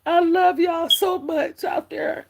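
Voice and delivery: high, slow and choked up with tears; happy tears. Real, not acted. Thanking her viewers out there, tearfully choked up. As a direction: let the happy tears break through the words. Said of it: "big crybaby today".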